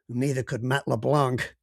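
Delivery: put-on slow dry American accent; deadpan line in an American accent. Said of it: "I hope my American accent wasn't far too off beam".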